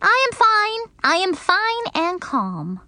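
put-on high childish voice; little girl trying to be calm. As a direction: insist you are calm. Junie B's voice in the story.

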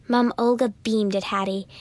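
ordinary delivery

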